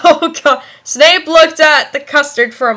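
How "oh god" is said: usual speaking voice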